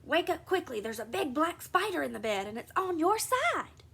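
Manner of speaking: put-on girl tries to scare her twin, urgent and scary. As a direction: frighten your sister awake with a fake alarm. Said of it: "making her voice scary and urgent".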